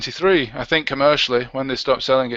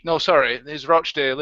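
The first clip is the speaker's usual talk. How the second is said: ordinary talk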